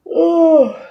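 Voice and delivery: loud, high yawn escaping; real yawn breaking through the word. Real, not acted. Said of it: "yawning is".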